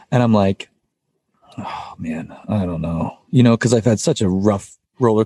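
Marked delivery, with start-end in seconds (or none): 1.6-3.2 low, hushed hesitation; reluctant. Retold or imitated. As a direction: sigh it out low and soft, full of doubt. Reluctant reply to realtor's church question.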